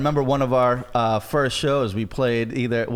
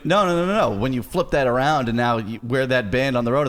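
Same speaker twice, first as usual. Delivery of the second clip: normal talking voice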